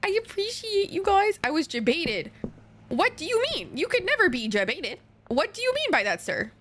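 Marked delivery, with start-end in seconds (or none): 0.0-1.4 high, excited, sing-song thanks; high squealed thanks. Pretend. Thanking gifted subs for the hype train in a high, excited squeal. 2.9-6.5 mock offended.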